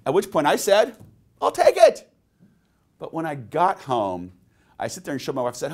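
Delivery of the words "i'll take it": sudden high, loud cry of delight; eager instant yes. Retold or imitated. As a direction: blurt it out like a thrilled shopper. Eager buyer snaps up suit.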